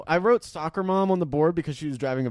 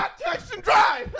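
high slow sarcastic shout; mock shout; slower than usual. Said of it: "I'm like".

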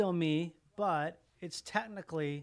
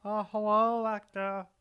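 slightly higher, louder put-on greeting; imitated character voice; 4 semitones higher, 4 dB louder, slower than usual. Host echoing the guest's new voice, lightly imitated greeting. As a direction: lightly mimic a character saying hello. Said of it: "I heard that voice right now".

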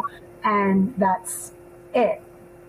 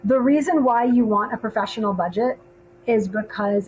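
usual speaking voice

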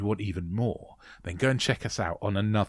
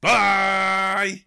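shouted bye; 10 semitones higher, 8 dB louder than usual. Real, not acted. Shouted goodbye at podcast end.

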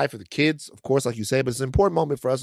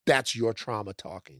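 slow, low, soft mock dismissal; imitated brush-off, slow and quiet; dismissive doubters; 5 semitones lower, 7 dB quieter, slower than usual. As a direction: play the doubter, lower and unhurried. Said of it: "and people go".

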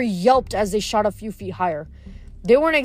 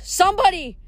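yelling for help; 9 semitones higher than usual. A narrator's reading. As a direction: yell desperately while dangling over the abyss. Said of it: "Piper s-, yelled".

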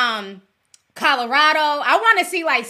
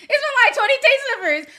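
high, laughing through the words; 12 semitones higher, 4 dB louder, much faster than usual. Real, not acted. To chat, laughing about twenty fans. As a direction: crack up as you say it. Laughs at small Minnesota turnout.

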